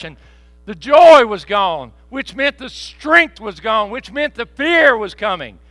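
loud, high, fervent revival preaching; preaching at full force. Acted. Pastor retelling Roger's prayer to the congregation, loud and fervent. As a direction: shout the testimony to the church.

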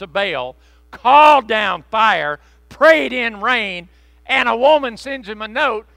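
loud, slow, building sermon cry; rising pulpit indignation, loud and slow; preaching at full force. Real, not acted. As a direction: preach it out, hammering each phrase.

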